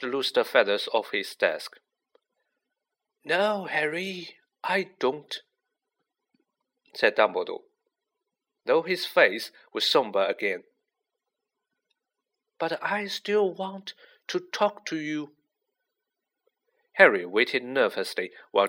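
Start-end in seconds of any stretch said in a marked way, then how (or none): 3.2-5.4 put-on unhurried old mentor, pitched high; kindly old headmaster. A narrator's reading. Voices Dumbledore in story. 12.6-15.3 high, slow, soft-edged wizard voice; unhurried old mentor, pitched high; kindly old headmaster. A narrator's reading. Voices Dumbledore in story.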